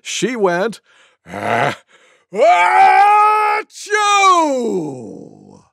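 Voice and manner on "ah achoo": long high shrieked sneeze; enormous drawn-out tiger sneeze; loudest sneeze imaginable. Acted. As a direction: wind up, then explode into "choo". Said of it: "the loudest sneeze you could ever imagine".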